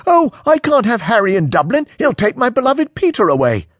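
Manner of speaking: very high, loud sarcastic mimicry; up an octave. A narrator's reading. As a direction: shoot your voice up into mocking falsetto. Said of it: "His voice has travelled up an octave".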